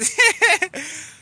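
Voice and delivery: high, loud burst of real laughter. Real, not acted. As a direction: laugh out loud at your own brag. Laughs at own boast.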